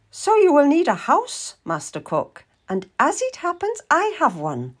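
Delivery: put-on high-pitched elderly lady of rank; Lady Maria. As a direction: lift the voice high, as a commanding old noblewoman. Plays Lady Maria in story.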